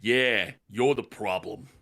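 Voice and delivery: low, put-on brawler voice; tough guy. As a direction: square up with a deep threat. Reads a brawler's threat.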